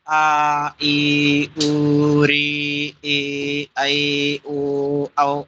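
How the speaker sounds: each vowel from आ to औ held for double length; long vowels held. Shown as a demonstration. Teacher recites the long vowels to students, each held double. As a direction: stretch every vowel, two beats each. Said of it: "अब इसको दो मात्रा का double हो जाएगा".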